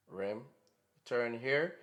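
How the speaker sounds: ordinary talk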